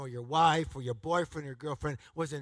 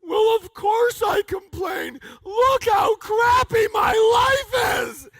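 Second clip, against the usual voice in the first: much higher, louder, slow whining yell; whiny complainer; 13 dB louder, much slower than usual. Retold or imitated. Complainer defending himself, hammed-up whine. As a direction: ham up a complainer. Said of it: "they go".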